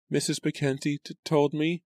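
put-on high, halting servant girl voice; stammering maid. A narrator's reading. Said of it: "Lucy stammered".

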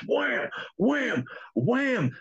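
imitated wham, wham, wham, sharp and high; metal bangs. Retelling the noise in the basement, imitated metal clangs. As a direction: bang it out like a bat on a can. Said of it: "sound like somebody took a metal trash can".

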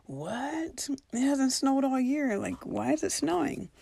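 astonished. Retold or imitated. Shocked by the snow.

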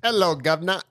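cheery London street greeting, played for laughs; jokey British accent greeting listeners; mock British accent.

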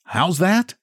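high, puzzled; pudgy man questioning the guide. A narrator's reading. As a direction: ask it bewildered.

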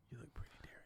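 very soft, voiceless whisper; teasing whisper. Pretend. Co-host teasing his friend about being approachable, whispered. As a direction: whisper the compliment like a secret.

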